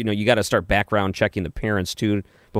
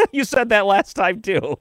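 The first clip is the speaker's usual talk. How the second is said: laughing, higher-pitched comeback; amused laughing; 12 semitones higher, slower than usual. Real, not acted. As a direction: say it through a real laugh, pitched up. Host amused by caller's repeated jab.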